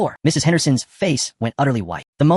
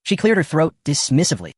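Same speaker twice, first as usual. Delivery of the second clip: ordinary delivery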